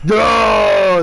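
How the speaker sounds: loud frustrated shout; shouted groan. Retold or imitated. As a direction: yell it out in frustration. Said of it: "the second time".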